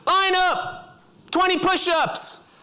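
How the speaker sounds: high-pitched master corporal yelling orders; screaming drill instructor. Acted. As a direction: scream commands at new recruits. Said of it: "he starts screaming".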